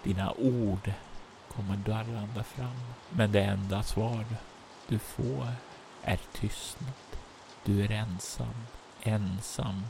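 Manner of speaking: soft narration with long pauses; phrase by phrase, hushed and suspenseful; game master's ominous narration. A narrator's reading. Game master's closing scene setting.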